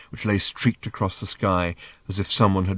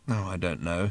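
softer, tired sigh of a line; weary; 2 semitones lower, 7 dB quieter than usual. Acted. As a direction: say it wearily, worn out. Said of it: "said Arthur wearily".

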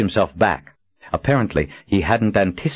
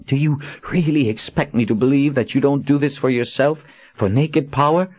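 higher, scornful challenge; heroine voice; 7 semitones higher than usual. A narrator's reading. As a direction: accuse him with scorn. Kahlan challenges him.

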